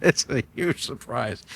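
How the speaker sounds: laughing nervously through the words. Real, not acted. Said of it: "And that's my nervous laugh".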